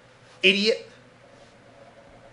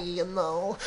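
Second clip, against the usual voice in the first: normal talking voice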